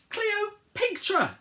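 high, loud, very drawn out; grand announcement. Acted. Pig Sticks announcing the queen, slow and grandiose. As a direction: stretch the name with a flourish.

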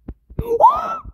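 very high, loud shriek of a gasp; startled shrieking gasp. Real, not acted. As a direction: scream at the jump scare. Jump scare.

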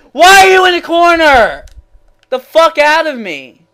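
high, loud furious yell; pissed off. Real, not acted. Gamer raging at an opponent in the corner, furious. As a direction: yell in outraged disbelief. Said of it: "I'm gonna get pissed".

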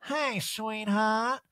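high, very slow, drawn-out greeting; Erica. Retold or imitated. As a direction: languid put-on diva voice. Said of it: "Jax is like, "Hello, Erica".

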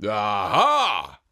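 loud, low gotcha exclamation; triumphant ogre cry. Acted. Oni catching the old woman, loud triumphant cry. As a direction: pounce on her with a loud aha. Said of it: "cried the Oni".

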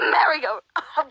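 ordinary delivery

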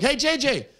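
high-pitched imitated hello; mock friendly reply. Comic greeting J J back, high mock-friendly hello.

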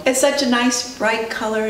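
plain reading voice

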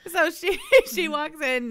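talking through a laugh, high and loud; can't hold the giggle back. Real, not acted. Gossiping to friend about the scandal, laughing through the words.

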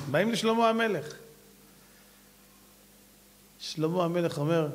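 ordinary delivery

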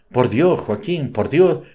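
ordinary delivery, nothing marked

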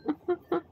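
high little practice giggle; awkward practice laugh. Shown as a demonstration. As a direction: try out a laugh, unsure. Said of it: "हंसना भी नहीं आता".

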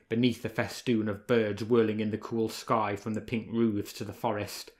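ordinary delivery, nothing marked

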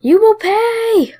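high, slow, drawn-out revenge threat; angry troll. Pretend. Voicing an angry rival cell, high mock revenge threat. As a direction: squeak out the vow like a furious little troll. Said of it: "look how angry my dude is".

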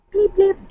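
high-pitched bleep over the company name; mock censor beep. Hiding the company name from the officer, high mock bleep. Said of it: "Di ko sasabihin".

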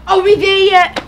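loud impatient whine; whiny moaning.

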